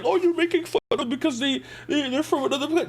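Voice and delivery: high, fast mock complaint; mock accuser. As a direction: imitate a whiny objector in a rush. Acting out an imagined critic.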